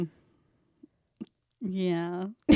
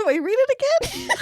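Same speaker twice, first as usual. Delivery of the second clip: laughing high question; 6 dB louder than usual. Real, not acted.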